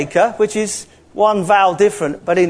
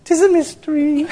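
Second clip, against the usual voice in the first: put-on high-pitched Scottish accent, teasing reveal; piping Scottish voice, as a playful punchline; Scottish preacher impression; 8 semitones higher than usual. Preacher delivering his punchline to eager listeners, high Scottish accent. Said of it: "and he'd say".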